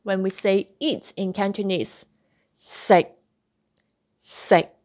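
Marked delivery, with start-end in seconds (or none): 2.5-4.7 "sick" cut short, the K stopped and never released; unreleased K. Shown as a demonstration. Teacher models Cantonese for eat, "sick" with stopped ending. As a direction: stop "sick" dead before the K escapes. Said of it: "It is a stop sound".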